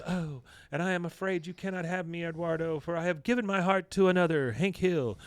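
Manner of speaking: high mock heroine voice; soap opera heroine. Heroine turning down her suitor in a daydream, hammed-up melodrama. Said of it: "a Mexican soap opera".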